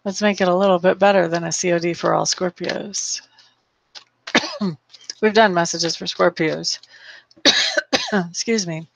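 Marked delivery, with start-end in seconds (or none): 4.3-4.7 coughing fit. Real, not acted. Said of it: "sorry about all the coughing today". 7.4-8.2 coughing fit. Real, not acted. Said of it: "sorry about all the coughing today".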